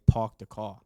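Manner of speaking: Staten Island accent, "Park" said "pawk" with a rounded aw; his own Staten Island version of "Park the car". Shown as a demonstration. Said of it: "I say".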